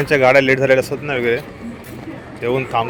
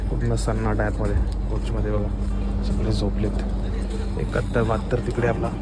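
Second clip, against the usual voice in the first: hushed voice so sleepers stay undisturbed; quiet at night; 2 semitones lower, 7 dB quieter than usual. Real, not acted. Inside coach, everyone asleep.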